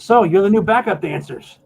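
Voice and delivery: high, loud leprechaun boss; band leader. Acted. Leader greeting the new backup dancers, high loud character voice. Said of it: "the leader guy looks and says".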